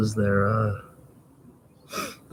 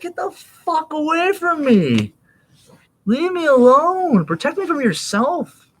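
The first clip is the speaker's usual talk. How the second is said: very high, slow pleading whine; terrified hobbit; slower than usual. Retold or imitated. Retelling Lord of the Rings, high slow terrified plea. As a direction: wail at him to stay away. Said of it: "It's like".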